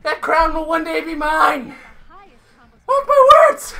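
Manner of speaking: hammed-up rival vowing revenge; mock villain vow. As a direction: proclaim it like a scheming rival. Mock villain vow over game.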